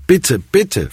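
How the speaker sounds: hoch gestelltes, verzweifeltes Betteln; flehend. A narrator's reading. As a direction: flehe ihn inständig an. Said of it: "flehte ich erneut". (hoch und flehend)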